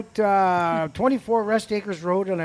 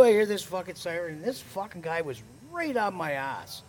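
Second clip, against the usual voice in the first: normal talking voice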